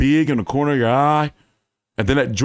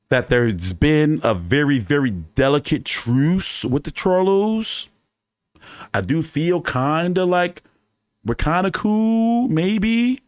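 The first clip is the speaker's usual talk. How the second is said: slow hesitant; slower than usual. Real, not acted. Hesitant hope of a truce.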